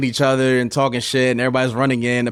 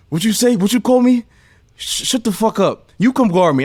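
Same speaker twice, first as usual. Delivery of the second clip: high, loud heated trash-talk comeback; angry yell; 9 semitones higher, 4 dB louder than usual. Retold or imitated. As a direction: get in his face, furious. Josh riled up, yelling.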